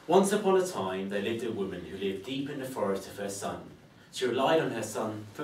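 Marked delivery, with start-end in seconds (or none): 0.1-1.1 raised, ringing fairy-tale opening; story opening. Acted. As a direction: announce the tale to the room.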